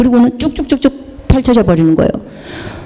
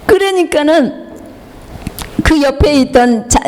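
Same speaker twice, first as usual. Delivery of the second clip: ordinary delivery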